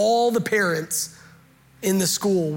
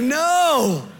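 high, loud wail, easing off in volume; mock dismayed cry; 5 semitones higher, 4 dB louder, slower than usual. Parent fearing the kid will ruin the play, mock dismay. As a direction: imitate a parent watching disaster unfold.

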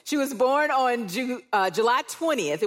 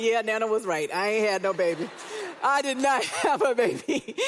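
1 laughing admission. Retold or imitated. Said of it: "I'm like".

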